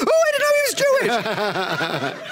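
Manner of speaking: high, drawn-out imitation of a surprised student; hammed-up amazed realization, stretched out for laughs; mock astonishment. Students' reaction to professor's holiday greeting.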